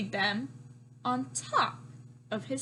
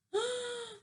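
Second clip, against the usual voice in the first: surprised gasp; 10 semitones higher than usual. Real, not acted.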